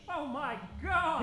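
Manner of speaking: loud, slow gasp of shock; shocked exclamation. Acted. Doctor Zander sees the Yeti through the binoculars, loudly shocked. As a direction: blurt it out in horror.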